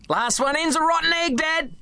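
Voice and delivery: put-on a boy daring his dad to race into the water, gleeful; excited shout. Boy racing dad into surf.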